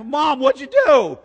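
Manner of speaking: loud, high, alarmed cry; alarmed shout at mom. Retold or imitated. Son confronting mom after the audit letter, shouting in alarm. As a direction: yell it at mom in shock. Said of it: "I asked".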